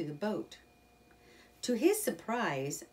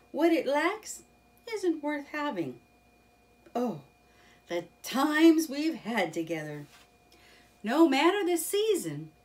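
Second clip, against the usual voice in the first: enthusiastic Rat; 9 semitones higher, 7 dB louder than usual. Acted. Rat praises river life.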